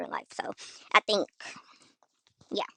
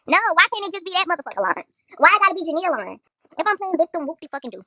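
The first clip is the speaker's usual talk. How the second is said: higher, louder, and fast by the end; angry shout; 5 semitones higher, 7 dB louder than usual. Real, not acted. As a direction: fire it back in outrage. Angry rant about being called a liar.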